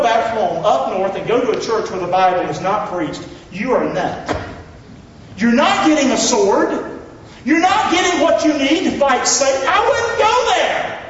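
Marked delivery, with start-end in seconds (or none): 5.4-11.0 high, loud fiery preaching. Acted. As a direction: build to a fever pitch. Preacher getting fired up.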